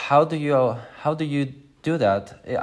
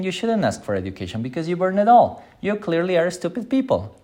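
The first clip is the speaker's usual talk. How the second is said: condescending mock; 8 semitones higher, faster than usual. Mock lecture to Haitians.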